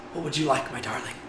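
the king murmuring lovingly to Esther; hushed loving question; tender king. Retold or imitated. King tenderly answers his queen.

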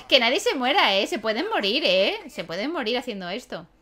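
usual speaking voice

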